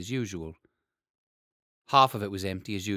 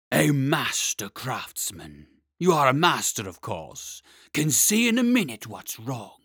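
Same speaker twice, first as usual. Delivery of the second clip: slow, loud dragon flattery; butter him up in a heavy beast voice; flattering dragon; 3 semitones higher, 7 dB louder than usual. Acted.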